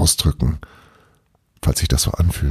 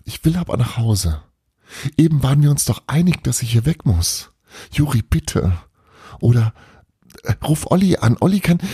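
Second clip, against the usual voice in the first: begging higher and faster, close to tears; desperate pleading; 7 semitones higher than usual. Retold or imitated. Drugged patient begging her brother Juri to take her home, panicky pleading. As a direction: plead like everything depends on it, voice climbing. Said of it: "Vor lauter Verzweiflung fange ich an zu weinen".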